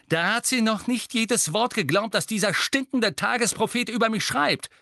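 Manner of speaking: high, forceful angry interjection; loud. A narrator's reading. Harry complaining about the Daily Prophet, loudly. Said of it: "sagte Harry laut".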